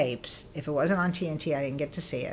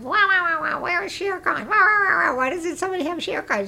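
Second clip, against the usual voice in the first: high, sing-song mock whine; whining; 12 semitones higher, 4 dB louder than usual. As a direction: pester your roommate with a childish whine. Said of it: "Stop whining about it".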